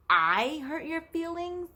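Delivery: high, slow disbelieving question; incredulous. Retold or imitated. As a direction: echo it back in mock shock. Nanny's incredulous reply to child.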